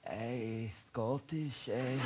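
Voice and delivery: put-on a tired, mumbled stab at a Scottish accent; weary Scottish accent. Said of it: "if I can do a Scottish accent".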